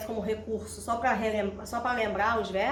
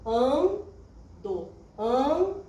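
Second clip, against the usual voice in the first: "Ando" said twice, weight on the first syllable AN; stressed syllable demo; much slower than usual. Shown as a demonstration. Teacher showing students the stressed syllable. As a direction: lean on the AN of "Ando" to show the stress. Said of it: "Sílaba tônica".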